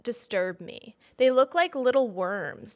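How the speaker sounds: usual speaking voice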